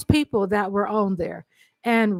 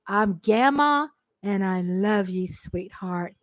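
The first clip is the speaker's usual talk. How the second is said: tender; 4 semitones lower, 5 dB quieter than usual. Real, not acted. Tender words to grandson.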